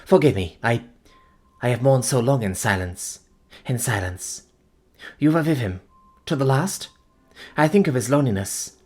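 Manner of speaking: tearful mourning. Acted. Fiancée asking about his last hours, tearful. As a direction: ache for his loneliness.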